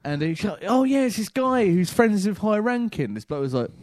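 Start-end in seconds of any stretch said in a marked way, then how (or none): 0.6-3.1 excited boasting. Retold or imitated. Lad boasting on phone.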